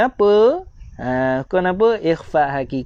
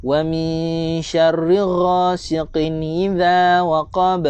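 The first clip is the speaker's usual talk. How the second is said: Quran recitation. Shown as a demonstration. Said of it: "Tuan-tuan di rumah boleh baca bersama-sama dengan saya". (bacaan tartil surah penuh)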